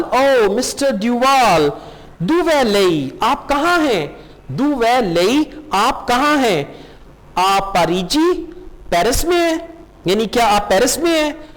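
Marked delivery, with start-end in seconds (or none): none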